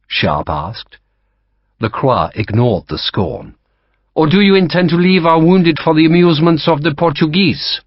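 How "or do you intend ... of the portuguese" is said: polite French officer, higher and louder; courteous French-accented captain. Acted. French captain to Sharp, polite French accent. Lacroix voiced.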